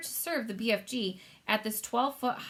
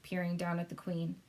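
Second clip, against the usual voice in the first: ordinary delivery, nothing marked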